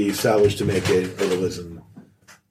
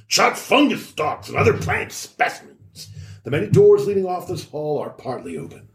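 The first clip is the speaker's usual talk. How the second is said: read-aloud narration; 4 semitones higher than usual. Acted. Reads boxed read-aloud text.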